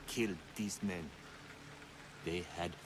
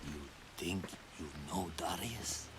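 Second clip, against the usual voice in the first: hushed, taunting whisper; 5 dB quieter than usual. Acted. Cultist whispers a taunt to the warrior. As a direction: breathe the doubt into his ear.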